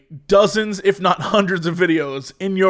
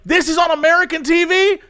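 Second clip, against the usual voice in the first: disbelief; 8 semitones higher than usual. Retold or imitated. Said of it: "I was like".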